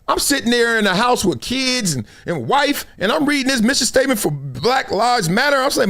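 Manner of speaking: ordinary talk, nothing marked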